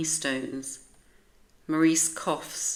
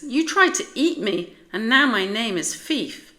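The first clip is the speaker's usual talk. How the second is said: higher, louder tiny dog voice; indignant small dog; 6 semitones higher, 7 dB louder than usual. A narrator's reading. Fief scolds Maurice for eating her stones, high and indignant. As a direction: complain with injured pride. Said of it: "Fief says".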